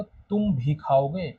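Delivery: तुम punched, the whole line slow and drawn out; teacher leaning on तुम in "तुम भी खाओगे"; stress on तुम. Shown as a demonstration. Teacher showing the class: तुम said with weight. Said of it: "तुम पर जोर दे रहा है".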